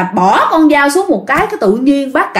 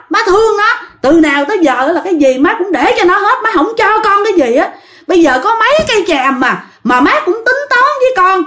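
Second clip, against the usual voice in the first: higher, heated complaint at his mother; angry son's outburst; 8 semitones higher than usual. Retold or imitated. Son scolding his possessed mother, raised and angry. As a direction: rant at her, pitch climbing.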